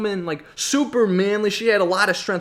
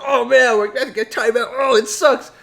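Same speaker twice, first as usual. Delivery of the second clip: mock dismay; 4 semitones higher, 4 dB louder than usual. Boys are given a timeout, hammed-up groaning. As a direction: whine it like a punished schoolboy.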